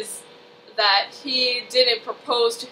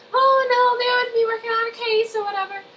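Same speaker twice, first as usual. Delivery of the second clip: sly agent lady; 12 semitones higher than usual. Retold or imitated. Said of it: "the evil Southern F B I lady".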